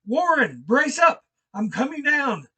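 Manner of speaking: high, loud call of encouragement; loud, high, bracing shout down the stairs; shouted resolve. Acted. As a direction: shout down to steady your friend. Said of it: "I formed and shouted a resolution".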